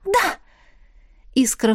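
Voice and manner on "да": high eager yes; an eager tiny spirit; sprite voice. A narrator's reading. Sprite answers. (высокий голосок духа)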